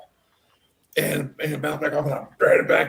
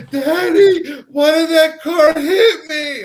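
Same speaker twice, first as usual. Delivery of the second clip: put-on high, loud, tearful child's wail; crying little girl; 13 semitones higher, 9 dB louder, slower than usual. Storyteller mimics a crying girl asking her daddy, high tearful wail. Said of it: "I hear this little girl crying".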